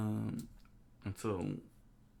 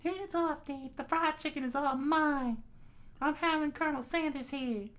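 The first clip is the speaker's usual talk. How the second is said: very high squeaky character voice; high-pitched figure staking a claim to food; possessive story character. Acted. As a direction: put on a high, far-from-natural voice.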